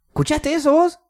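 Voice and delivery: very high-pitched retold question; acted high squeak of alarm; incredulous question. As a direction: ask your friend in high-pitched disbelief. Asks friend in retold exchange.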